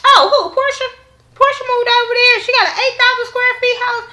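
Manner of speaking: high, bratty mock of Kenya spilling the tea. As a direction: ham up a catty, showy know-it-all. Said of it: "Kenya was like".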